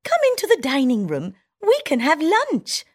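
high squeaky mouse, quick and eager; town mouse voice. Acted. Town mouse inviting her friend to lunch, eager high squeak. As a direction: hurry your friend to the table.

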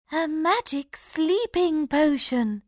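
high excited princess; eager idea; cartoon princess. A narrator's reading. Princess voiced.

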